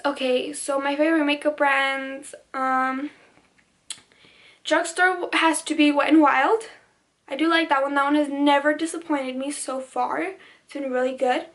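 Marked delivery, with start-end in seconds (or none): none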